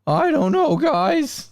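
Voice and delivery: put-on very high squeaky teenager; squeaky voice teen. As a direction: push the pitch way up like a cartoon teen. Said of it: "Squeaky voice teen".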